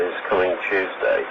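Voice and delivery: ordinary delivery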